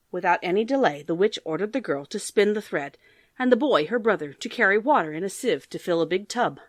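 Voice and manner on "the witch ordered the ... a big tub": ordinary delivery, nothing marked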